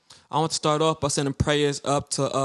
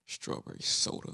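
whispered mock disgust; 11 dB quieter than usual. Real, not acted. Mock disgust at soda choice.

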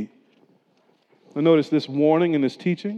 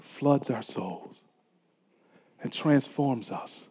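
hushed preaching; 7 semitones lower, 7 dB quieter than usual. Acted. Pastor to congregation, hushed reverent preaching. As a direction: draw the congregation into awe quietly.

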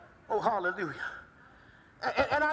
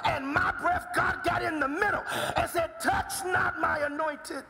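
building preaching; 7 semitones higher than usual. Acted. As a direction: let the sermon catch fire. Preacher builds to full force.

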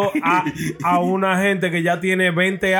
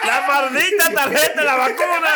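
high-pitched joking grand reveal, laughing; playful showbiz proclamation; mock announcer; 8 semitones higher than usual. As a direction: join in the hammed-up introduction, amused. Joins the mock announcer presentation.

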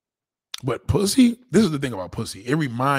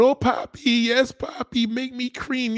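high, breathy-free squeal of papi lines, much higher; 10 semitones higher, slower than usual. Retold or imitated. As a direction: camp it up as a moaning Latin lover. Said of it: "they all go aboard".

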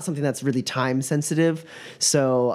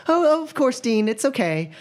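high, stammering fan voice; flustered student; 11 semitones higher than usual. Acted. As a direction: gush nervously over the famous team. Mila answering dean.

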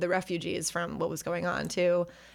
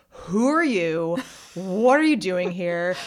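slow, louder demand, a touch of anger; mock angry local; 5 dB louder, slower than usual. Local man confronting Jane on the trail: slow, louder, mildly angry. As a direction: slowly challenge the intruder, half acting. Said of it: "pissed at her".

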